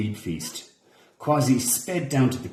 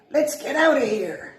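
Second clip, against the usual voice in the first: escaping iguana. A narrator's reading. Iguana fleeing.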